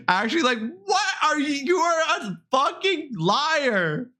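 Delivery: high pitched, laughing outrage; laughing disbelief calling a friend a liar; laughing accusation. Real, not acted.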